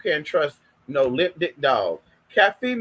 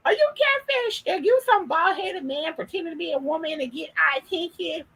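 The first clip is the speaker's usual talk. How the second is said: pitched way up, mock whiny questioning; mimicking dating-app guys who doubt she's real; mocking suspicious guys; 10 semitones higher than usual. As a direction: imitate the men in a high mocking voice. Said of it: "and they're like".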